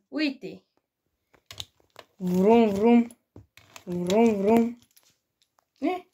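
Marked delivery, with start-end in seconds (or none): 2.2-4.8 car engine noise. Pretend. Imitates car engine for child.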